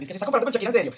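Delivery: high, loud, scoffing mock voice; mocking critics. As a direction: sneer it back at the critics in a high pitch. Mocking critics who compare him to [name]. (imitación burlona y aguda de los críticos)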